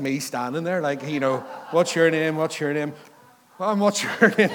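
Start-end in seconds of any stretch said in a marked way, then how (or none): 1.7-2.9 louder roll call of names; brisk questions down a line of children; imitated swim teacher. Imagines swim teacher going round the kids. 3.6-4.5 higher, louder, laughing question; put-on surprise at the odd one out; hammed-up teacher, breaking into a laugh; mock teacher greeting. Teacher reaches the lone adult among kids.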